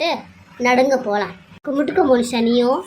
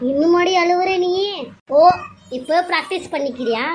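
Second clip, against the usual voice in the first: mocking taunt; 7 semitones higher than usual. Mocking the crying mother.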